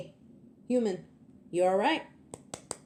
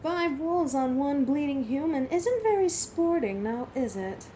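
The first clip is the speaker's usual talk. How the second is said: raised, taunting voice at the pack; mocking challenge; 7 semitones higher, 4 dB louder than usual. Werewolf to the pack of wolves, loud mocking taunt. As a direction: call out their cowardice, loud and sneering.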